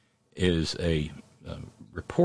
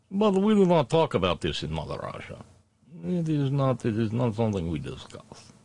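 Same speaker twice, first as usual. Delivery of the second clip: mock Russian accent; 5 semitones higher than usual.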